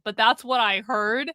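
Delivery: ordinary delivery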